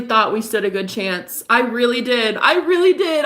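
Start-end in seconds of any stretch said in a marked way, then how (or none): 2.4-3.2 much higher, louder, pleading repeat; voice breaking. Real, not acted. Insisting to viewers she believed in him, voice breaking. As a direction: let it break upward as the hurt hits.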